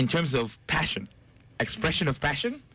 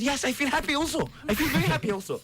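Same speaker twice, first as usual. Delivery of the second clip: excited; 9 semitones higher than usual. Retold or imitated. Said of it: "He said".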